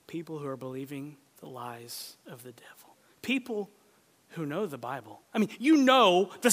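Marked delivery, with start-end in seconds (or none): 0.1-3.0 whisper. Real, not acted. Sermon point whispered.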